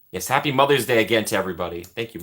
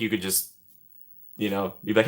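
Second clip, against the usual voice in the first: ordinary delivery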